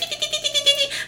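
high soft embarrassed laugh. Real, not acted. Embarrassed at sounding like a crazy girlfriend, laughing. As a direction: laugh it off sheepishly.

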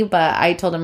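ordinary talk

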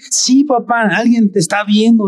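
a lightly voiced child pressing his point; insisting child.

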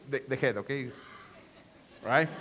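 ordinary talk, nothing marked

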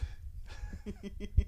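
soft breathy chuckle; real giggle. Real, not acted. Storyteller amused by the mom's question, giggling. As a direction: giggle partway through telling it.

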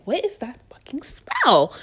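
hushed, high alarmed whisper. Retold or imitated. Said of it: "I'm like".

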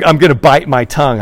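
loud mock vow.

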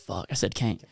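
normal talking voice

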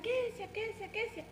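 high-pitched call of her name; mock calling her across the schoolyard; retold calling out. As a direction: parent calling a child in a crowd, high call. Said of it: "me gritaban".

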